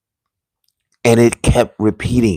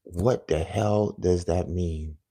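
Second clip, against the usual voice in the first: slow, much softer self-question; asking himself quietly; 3 semitones lower, 11 dB quieter, slower than usual. Retold or imitated. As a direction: murmur it to yourself, slowly, shaken. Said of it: "I've said to myself".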